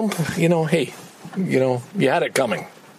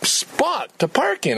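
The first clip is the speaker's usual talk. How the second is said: slow, high, drawn-out frustrated groan; exasperated; 11 semitones higher, slower than usual. Real, not acted. Ranting into the camera about no parking spot, exasperated. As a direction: drag it out in disbelief.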